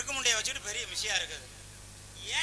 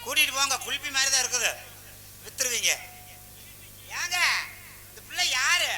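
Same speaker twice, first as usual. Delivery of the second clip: louder exasperated calling out; loud complaint; 3 semitones higher, 5 dB louder than usual. Acted. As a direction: raise your voice in comic exasperation. Loud complaint about the girl.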